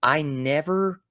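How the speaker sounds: ordinary delivery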